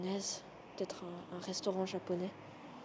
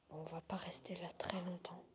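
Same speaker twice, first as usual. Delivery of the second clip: nervous night vlog whisper; 6 dB quieter than usual. Real, not acted. Unstable kitchen, back to whisper.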